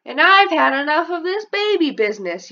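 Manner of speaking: loud high angry yell; boy yelling back at dad. Acted. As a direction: yell it like a fed-up kid. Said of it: "you'll yell".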